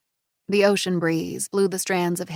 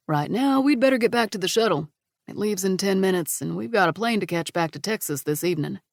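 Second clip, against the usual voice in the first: a hint of a proud dad; friendly father chatting; lightly voiced father. Brock's voice.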